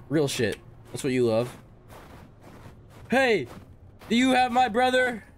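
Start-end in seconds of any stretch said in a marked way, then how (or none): 3.1-5.2 high, loud shout; calling out. Real, not acted. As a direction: call out into the dark. Calling out at log cabin.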